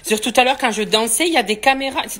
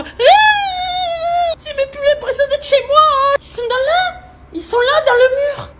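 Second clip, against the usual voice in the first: much higher, screechy panicked cry; high frantic wail of panic; panicked shriek; 4 dB louder, slower than usual. Real, not acted. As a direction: scream it like they're in the walls. Wasps in the walls.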